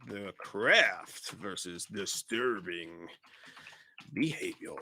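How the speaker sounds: low, soft, drawn-out matchup title; slow dramatic announcement. Pretend. Host introducing the next movie matchup, slow and hushed. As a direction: stretch each word out quietly, with a long pause before the last.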